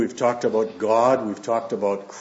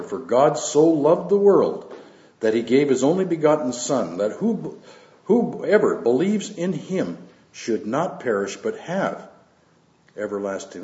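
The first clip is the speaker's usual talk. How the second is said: raised, measured Bible reading voice; scripture reading; 3 semitones higher than usual. Acted. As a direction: read the Gospel out to the church with weight. Reads scripture aloud to congregation.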